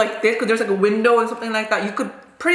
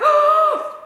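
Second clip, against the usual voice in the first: loud, high intake of breath; shocked gasp; 4 dB louder than usual. Retold or imitated. Pupil just slapped by her teacher, acted shocked gasp. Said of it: "like that".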